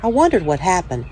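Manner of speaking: put-on calm, steady read-aloud voice for children; story reading. A narrator's reading. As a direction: tell it gently to young listeners, like at story time. Reads children's picture book aloud.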